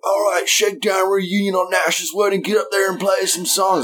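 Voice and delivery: high, strained crowd holler; put-on high party-guest voice; mock heckler. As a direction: goad the band from the sidelines. Imagined wedding crowd goading musician on stage.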